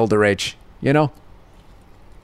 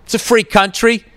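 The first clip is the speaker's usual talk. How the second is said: mock-innocent dad voice, pitched up; defiant dad; 7 semitones higher than usual. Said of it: "And he's like".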